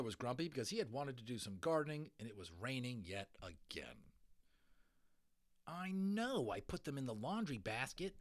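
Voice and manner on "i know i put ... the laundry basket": a touch of fretful whining; lightly voiced anxious boy. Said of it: "Adil was saying fretfully".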